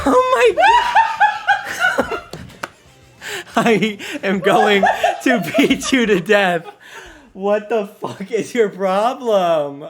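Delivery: high, loud yelling through laughs, slow; shocked yelling. Real, not acted. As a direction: yell it half laughing, still shaken. After the scare, yelling at friend.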